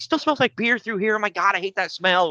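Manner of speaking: high-pitched whiny disgust; whiny friend. Retold or imitated. Mocking Greg's fuss about spilled beer, high whiny disgust. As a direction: squeal it high like a fussy friend who hates the smell. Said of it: "He's like".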